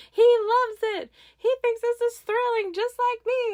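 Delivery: very high, gleeful squeal with a laugh; delighted thrill-seeker squealing over the ride; acted thrilled glee. Wife's thrilled thought, acted.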